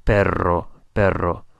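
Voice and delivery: perro with the R rolled like a dog growling. Shown as a demonstration. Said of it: "think of a dog growling and that R sound".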